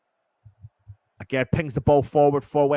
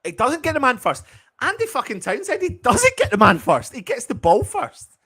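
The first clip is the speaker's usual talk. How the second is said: higher, insistent protest against the pundit; indignant protest; 11 semitones higher, 4 dB louder than usual. Real, not acted. Fan protesting to pundit Andy Townsend, indignant and high. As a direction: argue it hard, voice climbing. Fan arguing about a tackle.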